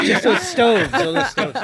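high laughing repeat. Acted. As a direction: giggle it out.

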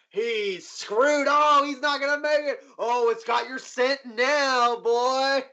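high mocking crowd voice, slowing down; jeering onlookers. Bar onlookers taunting the player, high gleeful mock. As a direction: tease him like a gleeful spectator. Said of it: "And people are like".

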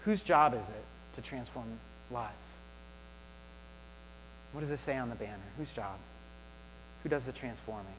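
muffled through a face mask. Real, not acted. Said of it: "it's hard to speak with the mask on".

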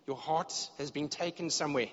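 ordinary talk

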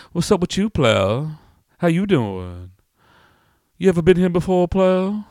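loud, high swooping greeting; nosy customer. Acted. Customer greeting a first-timer, loud high-pitched familiarity. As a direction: size up a newcomer in a high loud lilt. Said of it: "and he's all".

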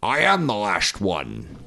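slow, slurred-s Scottish movie star; Sean Connery. Acted. Guest asked for an impression, slow Sean Connery voice. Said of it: "Can you do a Sean Connery?".

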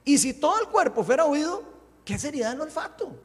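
high, loud proclaimed verse; scripture reading. Real, not acted. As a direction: read it out forcefully to the congregation. Reads scripture aloud. (lectura bíblica enérgica, en voz alta)

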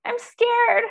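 hammed-up fright, fading off; mock scared voice. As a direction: play fear as a small scared creature. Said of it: "fear will go".

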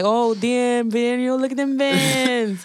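put-on high, drawn-out gushing teen girl; teenager voice. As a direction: gush over his shoes like a teen. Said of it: "in a teenager voice".

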